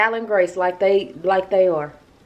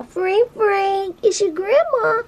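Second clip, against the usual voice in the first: calling grandson; 11 semitones higher, slower than usual. Real, not acted.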